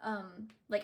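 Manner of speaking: ordinary talk, nothing marked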